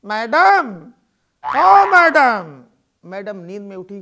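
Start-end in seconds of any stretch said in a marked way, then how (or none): 0.1-2.6 loud, drawn-out call. Acted. Watchman calling the actress at two at night, loud. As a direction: shout through the door.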